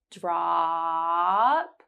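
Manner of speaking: "Drop" with the ah held long. Shown as a demonstration. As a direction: stretch the ah of "Drop". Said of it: "hold ah for three seconds".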